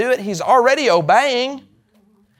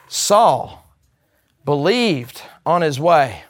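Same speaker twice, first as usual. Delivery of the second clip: ordinary talk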